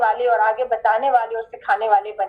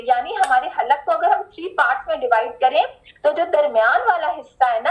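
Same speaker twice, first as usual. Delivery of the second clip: ordinary talk, nothing marked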